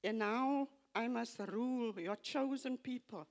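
normal talking voice

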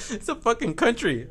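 cracking up at her own mistake; laughing. Real, not acted. Laughing at mixing up country and continent.

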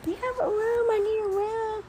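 high, pleading caller; needy caller voice. Retold or imitated. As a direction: mimic a desperate friend asking. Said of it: "People call me".

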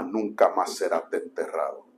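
very low, hushed, slow announcement; mock-solemn sermon title. Novice preacher telling friends his sermon title, mock-solemn. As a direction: intone it gravely, slow and soft.

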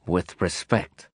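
slow, quiet words through clenched teeth. A narrator's reading. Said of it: "I said through clenched teeth".

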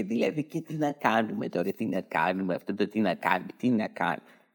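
low, soft, hesitant stammering minister; sheepish, mumbling politician; timid stammer. Retold or imitated. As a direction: mumble like a frightened dog caught out. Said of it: "σαν τρομαγμένος σκυλί".

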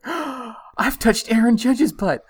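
high, loud and slow, amazed; excited kid. Retold or imitated. Dad imitating his son, thrilled over a ballplayer's butt, excited high and loud. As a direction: gasp it out like a thrilled eight-year-old. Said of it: "got real excited again".

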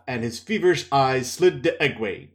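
usual speaking voice

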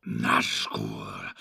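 hushed dread; shivering. Acted. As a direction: savour the word Nazgul with dread. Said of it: "shivering and licking his lips".

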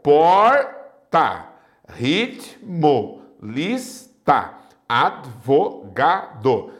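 Porta, ritmo, lista, advogado chopped into separate syllables with pauses; por-ta, rit-mo, lis-ta, ad-vo-ga-do, teacher's slow split. Shown as a demonstration. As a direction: break each word between the two consonants. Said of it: "separá-las em sílabas diferentes".

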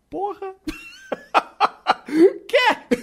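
high laughing "quê?"; laughing at an absurd twist; laughing disbelief. Real, not acted.

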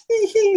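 very high coy "hee hee"; blushing giggle. Retold or imitated. Recruiter flattered by candidate's handshake massage, high blushing giggle. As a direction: imitate a tiny flustered titter. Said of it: "like, blushing".

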